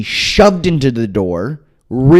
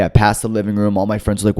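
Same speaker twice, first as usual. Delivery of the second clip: normal talking voice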